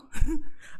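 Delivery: breathy high giggle; high unvoiced laugh. Real, not acted. Laughs at own attempt.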